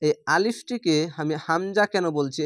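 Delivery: ordinary talk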